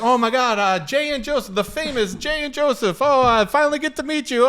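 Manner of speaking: high, gushing fan greeting; gushing excitement. Retold or imitated. Bride's father meeting the famous pair, gushing high. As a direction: gush over meeting them at last. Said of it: "he comes over and is like".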